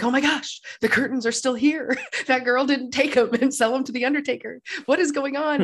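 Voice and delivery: high, excited exclaiming, lightly voiced; a lightly acted Scrooge, thrilled to find his curtains still there; giddy Scrooge. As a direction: gush it out in giddy disbelief. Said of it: "he's like".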